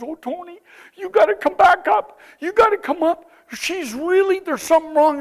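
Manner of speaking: a hint of a frightened villager begging; anxious woman. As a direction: plead urgently with Tony to come. Worried woman calls him back.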